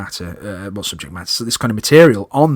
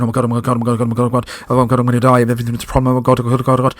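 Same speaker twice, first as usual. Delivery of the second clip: frantic panic; much faster than usual. Retold or imitated. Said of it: "like, really frantically, huffing and puffing, going".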